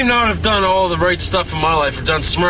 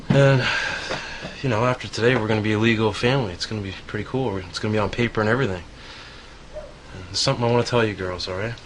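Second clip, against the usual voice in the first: tender speech; 5 semitones lower, 7 dB quieter, faster than usual. Acted. Speech to the girls.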